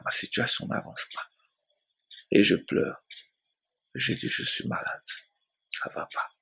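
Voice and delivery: soft, near-whispered complaint; barely able to voice the complaint; sick man whispering his despair; weak lament. Retold or imitated.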